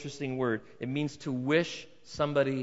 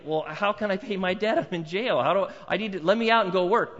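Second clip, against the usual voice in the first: louder, higher protest from a jail cell; pleading prisoner; 6 semitones higher, 7 dB louder than usual. Acted. A debtor in jail pleads with the guard, loud and exasperated. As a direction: beg to be let out to earn the money.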